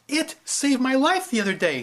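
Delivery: put-on a child crying out in protest; cried out. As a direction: protest desperately. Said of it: "she cried out".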